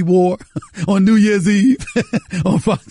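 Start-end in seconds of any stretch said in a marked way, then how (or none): 1.9-2.4 burst of laughter. Real, not acted. Laughing at Steve Harvey outfit.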